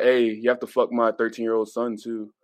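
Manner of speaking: normal talking voice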